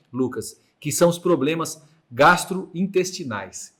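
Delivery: ordinary talk, nothing marked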